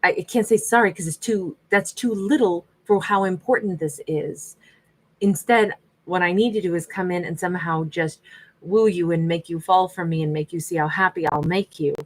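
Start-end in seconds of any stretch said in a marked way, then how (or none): none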